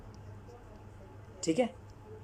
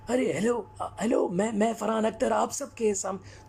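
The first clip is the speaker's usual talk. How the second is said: a light take on Farhan Akhtar, higher, pressed voice; slightly higher pressed Farhan Akhtar voice; Farhan Akhtar impression; 4 semitones higher than usual. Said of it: "जब फरहान अख्तर बात करते हैं".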